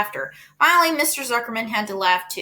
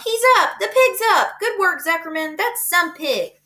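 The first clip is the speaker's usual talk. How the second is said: excited cheer; 8 semitones higher than usual. A narrator's reading. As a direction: whoop for the pig. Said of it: "yelled the crowd".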